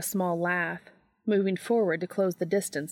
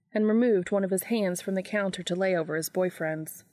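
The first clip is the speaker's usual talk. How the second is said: ordinary delivery, nothing marked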